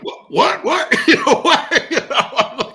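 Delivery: a veteran laughing as he mimics stunned rookies; mock baffled. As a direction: squeak out the confused rookies' shock. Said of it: "these guys are like".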